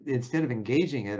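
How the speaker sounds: usual speaking voice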